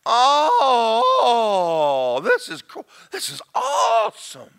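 high, loud drawn-out wonder; awestruck new convert. Acted. Preacher acts out a new believer walking around, awestruck gushing. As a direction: gush it, stretching the big words. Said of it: "you're walking around going".

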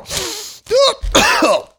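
choking cough from spicy fumes; high strained cough. Real, not acted. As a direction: cough it out, throat stinging. Face pushed into spicy bag.

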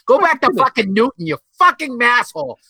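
high, hammed-up angry yelling; mock furious shout. Heckling the pretentious student, mock furious shout. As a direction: explode in mock outrage at a snob.

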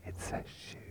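secret hushed whisper. Real, not acted. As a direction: whisper it so nobody hears. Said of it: "I'm gonna whisper".

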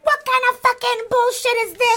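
very high, indignant squawk; hammed-up shrieky indignation without much volume; mock outrage. Retold bratty sister's outrage.

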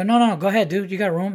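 overly polite, needling him to pass; mock polite. Telling the drunk guy to go ahead, mock polite.

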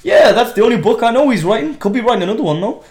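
high, loud, eager put-on voice; chatty old guy. Factory boss confirming the book, excited old-guy voice. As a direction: gush as an over-keen old man. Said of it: "like, an old guy".

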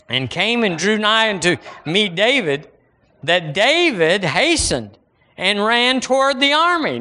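high, declaimed Bible verse; scripture reading. Acted. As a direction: proclaim David's challenge from the pulpit.

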